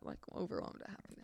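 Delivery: hushed retake of an awkward phrase; muttered self-correction, whispered; embarrassed whisper. Real, not acted. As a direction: whisper it under your breath, sheepishly. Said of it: "oh, that was so bad to say that".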